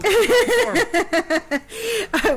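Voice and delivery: bursting into high, loud laughter; a real laugh breaking out while recalling mice jumping at stepmother. Real, not acted. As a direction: crack up at your own story. Laughing at her own farm story.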